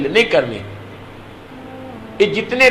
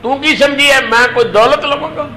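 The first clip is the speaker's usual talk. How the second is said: tearful; 8 semitones higher than usual. A narrator's reading. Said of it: "آپ رو کے فرمان لگے".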